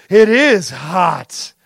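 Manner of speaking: loud, very drawn-out delivery; mock commercial actress. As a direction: drag it out as a hammy ad line. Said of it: "she's like".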